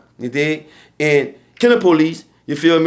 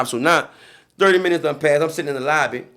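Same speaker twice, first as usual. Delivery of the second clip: usual speaking voice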